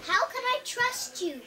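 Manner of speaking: high piglet challenge; doubting piglet. Acted. Pig doubts the begging witch.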